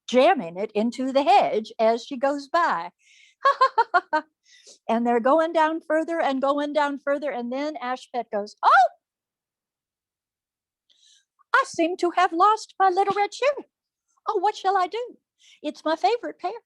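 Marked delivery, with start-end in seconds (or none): 3.4-4.2 high, tittering laugh; light, high acted laughter; put-on giggle. Ashpet giggling with the prince. 8.6-16.5 high, girlish mock distress; put-on high-pitched helpless voice; feigned dismay. Ashpet feigns losing her shoe.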